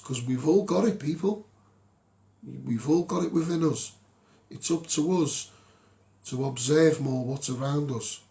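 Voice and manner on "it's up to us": ordinary delivery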